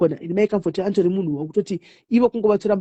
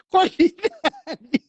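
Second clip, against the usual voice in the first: slow mocking; 8 semitones higher, much slower than usual.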